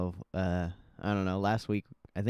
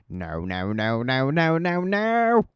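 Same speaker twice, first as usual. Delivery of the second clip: each no climbs higher and louder; rising mock protest; 4 semitones higher, 5 dB louder, slower than usual. Streamer playing around on stream, climbing to a high, loud yelp.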